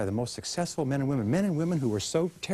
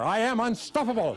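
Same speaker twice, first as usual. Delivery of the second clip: much higher, louder rallying cry; said like you mean it; 10 semitones higher, 3 dB louder than usual. Shown as a demonstration. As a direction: lead the crowd at full conviction. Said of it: "Once more".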